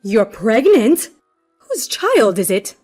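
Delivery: loud, drawn-out accusing question; loud, pointed, mother-in-law accusation; harsh. Acted. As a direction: demand it in harsh disbelief. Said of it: "were really harsh".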